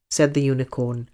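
ordinary delivery, nothing marked

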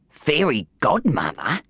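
slow gruff scoffing voice; a gruff voice in his head, slow and doubting. Acted. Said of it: "said a gruff voice".

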